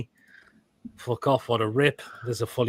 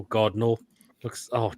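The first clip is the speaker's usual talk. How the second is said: normal talking voice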